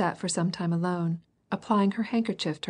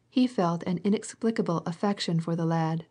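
ordinary delivery

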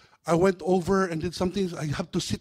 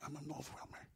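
breathy whispered declaration; 24 dB quieter than usual. Real, not acted. Preacher whispering to the congregation, quietly. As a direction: say it softly to yourself.

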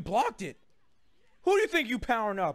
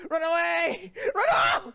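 high shout, climbing higher; loud, shrieked call to flee; panicked shout; 7 semitones higher, 4 dB louder, slower than usual. Real, not acted. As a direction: yell a panicked retreat. Fleeing an opponent in game.

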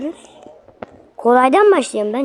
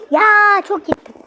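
loud, high-pitched complaint; exasperated cry; 10 semitones higher, 7 dB louder than usual. Real, not acted. Gamer overshooting a jump, exasperated. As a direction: cry out in real frustration.

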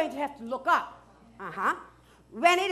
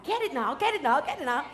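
ordinary talk

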